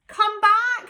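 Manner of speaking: high, loud call; shouting. Acted. Squirrel Cyril calls after the flying cone, high and loud. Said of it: "shouted Cyril".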